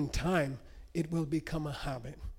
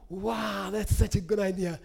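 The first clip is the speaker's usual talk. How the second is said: happy amazement; 3 semitones higher than usual. Retold or imitated. Lama admires the priest's colored windows, imitated happy amazement. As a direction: play a mildly thrilled admirer. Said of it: "so happy".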